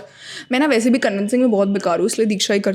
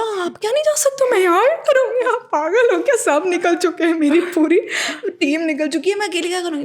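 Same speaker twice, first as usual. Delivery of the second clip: shrill-pitched panic, voice way up; frantic high protest; 8 semitones higher than usual. Retold or imitated. To the Singapore check-in staff, panicked high-pitched protest. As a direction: plead in disbelief, pitch soaring.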